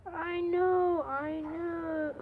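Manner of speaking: high, drawn-out consoling coo; sympathetic cooing. Real, not acted. Consoling a cat that wants in.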